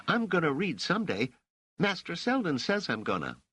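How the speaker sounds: put-on high-pitched kid insisting; embarrassed, defiant boy. A narrator's reading. Raich defends himself about reading, high defiant boy's voice. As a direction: protest like a stung street kid. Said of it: "Raich reddened".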